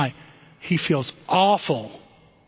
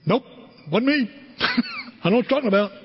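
Peter's denial; 7 semitones higher, much faster than usual. Acted. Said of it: "And he goes".

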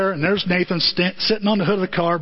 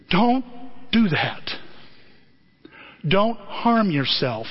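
lightly acted heavenly voice, slow and deliberate; slower than usual. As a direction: plead from above, word by word. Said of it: "there's a voice from heaven".

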